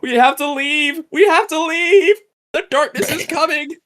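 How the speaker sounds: hammed-up panic, warning everyone; mock-frantic warning, high and loud; panicked delivery. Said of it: "funniest deliveries in this movie".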